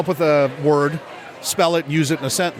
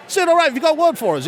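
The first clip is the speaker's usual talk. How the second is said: fast, high Australian presenter; Australian radio host; 11 semitones higher, 3 dB louder, faster than usual. Acted. Host asking second caller for a word, Australian accent. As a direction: rattle off the prompt in an Australian accent. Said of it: "this Australian radio station's holding a contest".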